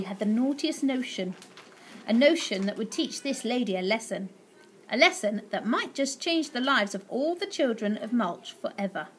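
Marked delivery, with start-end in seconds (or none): none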